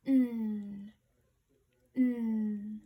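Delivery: "mm" held long and loud on its own; sound dictation. Shown as a demonstration. Teacher dictating sounds to pupils, long loud "mm". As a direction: hum a sustained, loud "mm", pause, repeat. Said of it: "our sound dictation".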